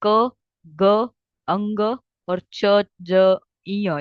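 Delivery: teacher sounding out the first, third and fifth letters; letters sounded out. Shown as a demonstration. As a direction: drill the alpprana letters slowly and firmly. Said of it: "कौन से?".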